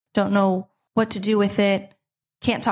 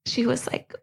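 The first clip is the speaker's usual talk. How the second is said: normal talking voice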